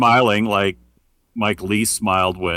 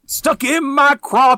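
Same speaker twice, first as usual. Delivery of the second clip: put-on old-timey voice; 6 dB louder, slower than usual. Mock old-timey drawl reply.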